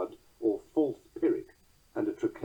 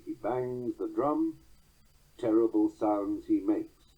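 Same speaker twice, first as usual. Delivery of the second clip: slow, a full stop after drum, then stress on terrible; caesura after drum; slower than usual. Shown as a demonstration. Lecturer demonstrating the scansion, a pause after drum. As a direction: let drum land, pause, hit terrible. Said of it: "A colon after drum gives a natural caesura".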